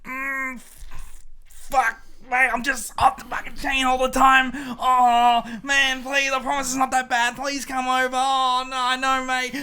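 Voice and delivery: hammed-up Armadale bloke impression; Armadale local impression. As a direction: beg mate to come over, playing it down. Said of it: "let me do an impression".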